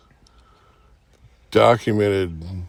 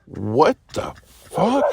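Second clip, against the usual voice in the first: slow, higher, rising question; stunned disbelief; 5 semitones higher, slower than usual. Retold or imitated. Storyteller reacting to his screaming friend, stunned disbelief. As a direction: draw it out in mild mock disbelief.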